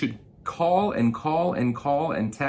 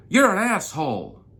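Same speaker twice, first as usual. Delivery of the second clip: high, louder mock snarl; imitating an angry caller; insult; 10 semitones higher, 6 dB louder than usual. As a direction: spit the insult like the rude customer. Retold customer insulting him.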